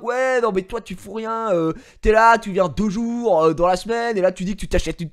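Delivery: loud, high mock rant at a slacker; hammed-up accusing tirade; mock furious troll yelling; imitating angry guy. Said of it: "mais genre, super énervé".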